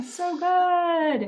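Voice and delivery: high, very drawn-out mock delight; gushing echo. Co-host echoing happy Megan, gushing echo. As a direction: stretch it out in pretend bliss.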